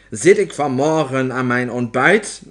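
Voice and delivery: loud declaiming witch; witch calling out. A narrator's reading. Opperheks telling the witches about her breakfast, calling out. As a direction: declaim it to the whole hall. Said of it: "riep de opheks".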